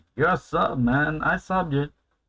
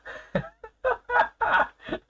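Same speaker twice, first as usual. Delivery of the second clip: high squealing laugh. Real, not acted. Laughing at chat pair.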